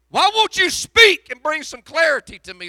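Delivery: loud, high, pleading preacher's cry; preaching at full force. Acted. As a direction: hurl the questions at heaven, full force. Pleading to God in sermon.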